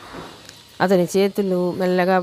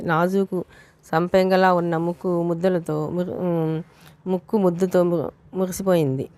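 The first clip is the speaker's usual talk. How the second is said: usual speaking voice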